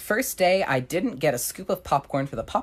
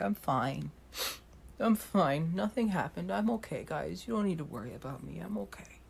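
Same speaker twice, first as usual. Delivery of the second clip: soft fake-brave voice, fading away; mock brave tearful; 4 semitones higher, 9 dB quieter than usual. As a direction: pretend to be hurt but insist you're fine. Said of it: "And I was like".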